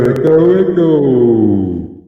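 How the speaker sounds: drawn-out word trailing away in echo; echo effect. Shown as a demonstration. Showing off the gadget's echo button, echoing voice. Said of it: "we can put some echo on".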